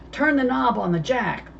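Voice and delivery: put-on trapped man shouting to the kids; shouted order. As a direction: shout the instruction over the fire. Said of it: "Lavender shouted".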